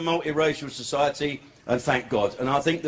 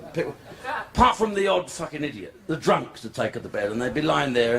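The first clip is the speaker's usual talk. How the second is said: ordinary talk, nothing marked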